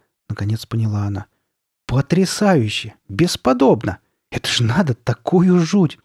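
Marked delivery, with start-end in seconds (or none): 1.9-6.0 high, loud, delighted exclamations; excited admiration. Acted. Sabrina marvelling at the scary mask, excited. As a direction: gush with amazement, pitch leaping.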